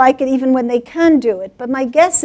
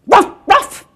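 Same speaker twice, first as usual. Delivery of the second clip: higher, loud double dog bark; 8 semitones higher, 6 dB louder than usual. Acted. Dog faces the mother cat, loud barking. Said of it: "and the dog goes".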